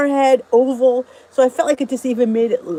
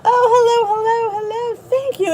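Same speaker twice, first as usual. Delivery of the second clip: excited greeting; 11 semitones higher than usual. Real, not acted. Greeting viewers joining live.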